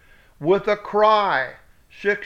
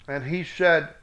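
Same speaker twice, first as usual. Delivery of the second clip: ordinary delivery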